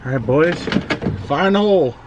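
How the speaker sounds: loud heads-up call, rising high. Real, not acted. Warning the boys as the oil plug comes out, loud call.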